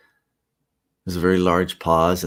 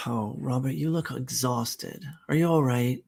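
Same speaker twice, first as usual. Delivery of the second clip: concerned; 4 semitones higher, 5 dB quieter than usual. Acted. Wife worried about tired husband.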